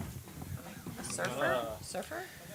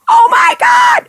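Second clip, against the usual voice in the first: high, loud cry of "Oh my God!"; fans screaming; 5 dB louder than usual. Retold or imitated. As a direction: shriek "Oh my God!" like a fan in the crowd. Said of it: "the fans are going".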